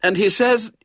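high, loud tattling, cut off midway; announcing. A narrator's reading. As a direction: carry on telling on him. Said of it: "he heard her announce".

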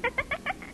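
short high amused giggle. Acted. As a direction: giggle at his antics. Amused laugh.

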